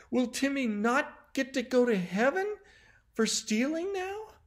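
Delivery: put-on high, plaintive little-girl question; near tears. A narrator's reading. Janie fearing for Timmy's place in heaven, near tears. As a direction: ask it high, on the verge of crying. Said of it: "Janie was near tears".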